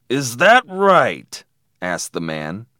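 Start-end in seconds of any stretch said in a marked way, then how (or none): 0.1-1.4 slow, loud, higher sneer; menacing challenge. A narrator's reading. Man answering the defiant boy, menacing challenge. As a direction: draw it out as a threat.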